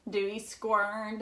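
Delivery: usual speaking voice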